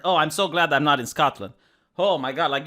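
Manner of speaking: usual speaking voice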